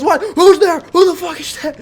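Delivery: high, loud, alarmed yell; a woken-up father bellowing down the stairs; startled dad shouting. Retold or imitated.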